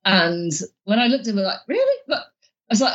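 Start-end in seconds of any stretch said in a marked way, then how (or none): 1.7-2.0 incredulous really. Retold or imitated. Shocked newcomer asking the peer if he means it, squeaked in disbelief. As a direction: pitch shoots way up in disbelief.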